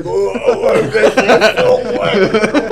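loud laughing surprise; startled exclamation. Retold or imitated. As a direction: shout it out, amazed and laughing. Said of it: "yo así".

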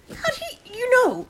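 shocked, high spluttered question; spluttering. A narrator's reading. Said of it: "she spluttered".

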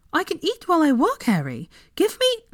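high, clipped-off protest; protesting Hermione. A narrator's reading. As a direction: push back, a little indignant. Hermione voiced higher in reading.

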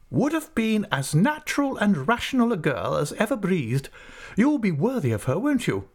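stern aunt. Acted. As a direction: play the decisive old aunt. Aunt speaks, voiced high.

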